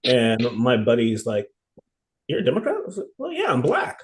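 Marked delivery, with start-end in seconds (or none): 2.3-2.8 much higher, incredulous question; surprised buddy. Retold or imitated. 3.2-4.0 much higher, matter-of-fact as if obvious; mock obvious. Speaker's own retold reply.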